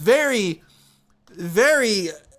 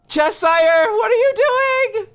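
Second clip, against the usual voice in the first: high, slow, anguished disbelief; exasperated cry; 11 semitones higher, 4 dB louder, slower than usual. Real, not acted. Caster wailing at Cheshire's blunder in disbelief. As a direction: wail at the player's mistake.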